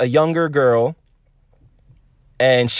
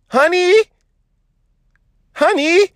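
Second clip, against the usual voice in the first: swooning call; 13 semitones higher than usual. Real, not acted. Swooning at the singer.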